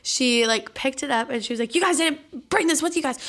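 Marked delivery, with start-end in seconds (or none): 1.7-3.2 higher-pitched retelling of an aunt's scolding; mock angry yell. Aunt scolding the cousins over a filing cabinet, mock yelling. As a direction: snap it out like the upset aunt, half-played. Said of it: "started screaming at us".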